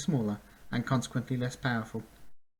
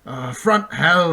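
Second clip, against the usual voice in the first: loud, slow exasperated yell; 5 semitones higher, 12 dB louder, slower than usual. A narrator's reading. Centaur yelling back at Bink, loud and slow. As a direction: yell back over the gallop. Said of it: "the centaur yelled".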